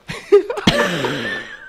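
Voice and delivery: high airy laugh; a breathless airy laugh; breathy laugh. Real, not acted. Guest laughs.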